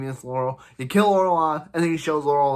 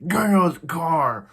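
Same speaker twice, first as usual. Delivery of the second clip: loud, higher Batman-style growl; hammed-up angry Batman voice; Batman impression; 4 semitones higher, 6 dB louder than usual. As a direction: bark it like an angry Batman parody. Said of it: "does his best Batman impression".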